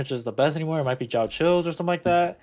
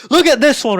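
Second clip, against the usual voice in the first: real hype, loud and high; too hyped; 11 semitones higher, 11 dB louder than usual. Real, not acted. Streamer showing off another piece, overexcited shout. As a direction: shout it excitedly, pitched way up. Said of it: "I'm getting too hyped for no reason".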